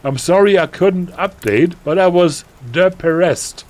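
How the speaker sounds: put-on high, loud, gushing teenage voice; melodramatic teen author. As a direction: read it like an overexcited goth girl. Reads author's note in character.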